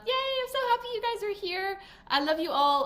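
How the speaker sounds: high squeal of delight; overflowing happiness; gushing joy. Real, not acted.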